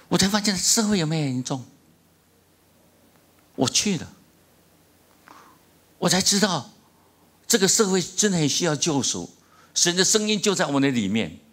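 impassioned preaching. Acted. Pastor stirring the congregation with a testimony, impassioned preaching. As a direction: preach it with rising fervor. Preaching the story at full force.

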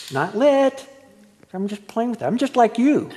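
defending his toy cigarette to coworkers; mock defensive. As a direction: plead your case, pushing back.

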